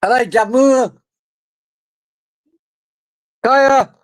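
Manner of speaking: high-pitched call to someone away. Real, not acted. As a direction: call them over, pitched right up. Calls to someone off mic.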